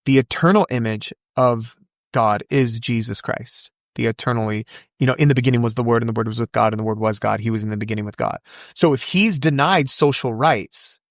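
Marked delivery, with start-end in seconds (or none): 5.1-8.4 very fast, low-key recitation from memory; rapid-fire recitation. Real, not acted. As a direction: rattle the verse off quickly. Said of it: "You said that so fast".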